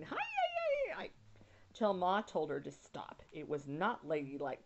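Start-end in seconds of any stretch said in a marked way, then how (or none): 0.0-1.1 put-on high excited yells of a little girl; girl yelling. A narrator's reading. Laura imitating the cowboys, high yelling. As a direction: wave and yell like a cowboy. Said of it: "waving her sunbonnet and yelling".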